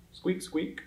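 high, soft little squeaks; mouse squeak. Shown as a demonstration. Teacher being a mouse for children, high squeaking. Mouse noise within the song.

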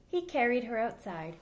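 put-on lively storybook voice for little kids; reading to children. A narrator's reading. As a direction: read it aloud like storytime for children. Reads picture book to children.

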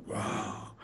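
hushed whisper on seeing a hero; awed whisper. Retold or imitated. Young drummer, seeing [name] on the couch, whispers in awe. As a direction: breathe it out in stunned awe. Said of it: "I'm like".

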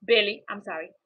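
ordinary delivery

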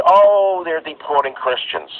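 mocking critic.

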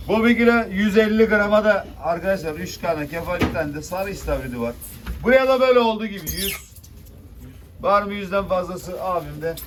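loud auction call; auctioneer. Real, not acted. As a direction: call the lot to the room. Fish auction bidding call.

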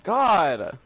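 slurping while talking; words through a slurp. Real, not acted. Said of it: "why are you slurping".

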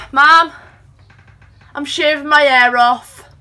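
loud call to another room. Real, not acted. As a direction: shout it to mum downstairs. Calling out to her mum.

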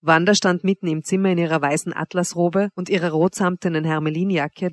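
plain reading voice

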